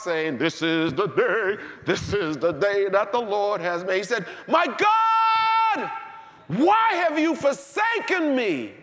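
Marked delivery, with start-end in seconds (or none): 0.4-4.0 mock chirpy recital. Preacher mocking the Instagram message, chirpy sing-song. 4.5-8.7 very high, loud, slow cry of abandonment; anguished shout. Acted. Jesus crying out to God from the cross, anguished shout.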